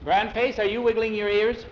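high, loud interrogation; stern questioning. Acted. Teacher questions boy about wiggling ears, stern questioning. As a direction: demand an answer from the accused.

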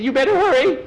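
high, loud, frantic warning; panicked warning. Acted. Skeeter warns Jackie as flame nears frosting, panicked warning. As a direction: warn urgently in a high squeal.

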